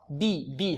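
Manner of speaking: "بي" with a clean, narrow ee; pure kasra. Shown as a demonstration. Teacher models the pure vowel, soft clear ee in "بي". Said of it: "كسرة خالصة".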